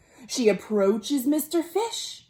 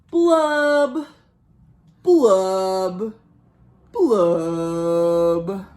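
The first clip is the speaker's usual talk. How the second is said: sad pouty fish blubbing; drawn-out mopey fish blubs; 5 semitones lower, 4 dB louder, much slower than usual. Acted. Storyteller voicing the glum fish, mopey blubs.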